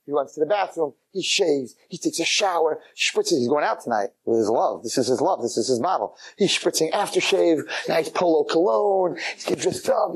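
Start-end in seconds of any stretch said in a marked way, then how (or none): none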